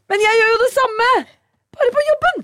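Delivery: high, loud exclaim of discovery; the penny drops, blurt it out; sudden realization. Retold or imitated. Mother realizing she overworks at home too, exclaiming loudly. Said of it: "Og så er jeg sånn".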